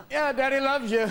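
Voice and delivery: high, strained fake cheer; groggy sarcastic dad. Tired dad answering kids at dawn, forced cheer. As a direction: force out the love through gritted teeth. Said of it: "And I'm like".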